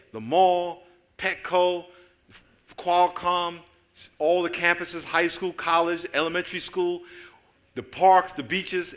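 loud, driving sermon list; preaching at full force. Real, not acted. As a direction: hammer each place home to the congregation. Lists places while preaching.

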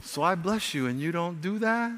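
soft, low, tender questioning; hushed, mild reproach as God; very gentle. Acted. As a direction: say it gently, no yelling. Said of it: "say it very gently".

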